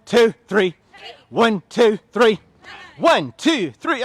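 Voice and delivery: loud, high count-off over the music; energetic coach calling reps; shouted counts. Real, not acted. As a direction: bark the reps out to the class.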